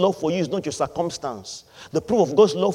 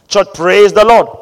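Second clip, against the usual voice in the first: louder, demanding praise the Lord; rally the congregation louder; louder call to the church; 3 semitones higher, 11 dB louder than usual. Shown as a demonstration. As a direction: call the church to answer back. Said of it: "I am saying it again".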